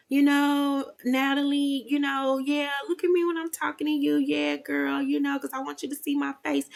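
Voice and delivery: mock her sweet, small voice; soft high voice. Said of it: "also changed the bass in her voice".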